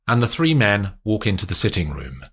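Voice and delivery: ordinary delivery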